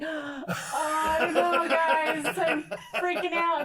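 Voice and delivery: high, slow, half-laughing fright; mock panic. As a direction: play up the fear at the edge. Scared at cliff edge.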